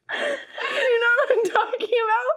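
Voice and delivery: high, slowed words through tearful laughter; can barely ask through the laughing; crying with laughter. Real, not acted. Asking her co-host about Shrek, crying with laughter. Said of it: "Because you're crying".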